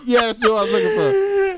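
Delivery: high laughing squeal; giggling admission of a mix-up. Real, not acted. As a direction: crack up mid-sentence, pitch way up. Laughing at own mistake.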